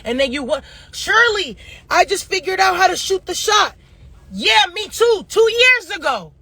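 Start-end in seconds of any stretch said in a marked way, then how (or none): 0.9-3.8 high-pitched imitation of a clueless client; mocking, raised-pitch boast; mock proud discovery. Mimicking a late-realising client. 4.3-6.3 high, exasperated comeback; exasperated sarcasm in a raised pitch; sarcastic retort. Acted. Sarcastic retort to the mimicked client.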